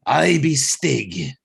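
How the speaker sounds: slow, hammed-up bad Australian accent; horrible Australian accent. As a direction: drag it out in a cheesy Aussie growl. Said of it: "horrible Australian accent".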